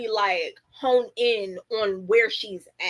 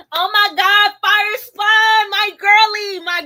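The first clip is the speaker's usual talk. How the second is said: much higher and louder, gushing hello; light up greeting your online bestie; excited squeal; 10 semitones higher, 9 dB louder, slower than usual. Real, not acted. Streamer shrieking hello to her internet friend, thrilled.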